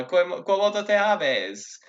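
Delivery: lilting mock-Roman chit-chat; Romans chatting. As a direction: play Romans exchanging pleasantries in Latin. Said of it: "they would say".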